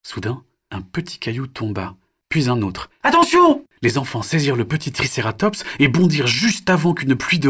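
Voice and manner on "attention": put-on high, loud child's warning shout; alarmed cry. Children warning each other of rockfall, loud high cry. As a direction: yell to warn of falling rocks.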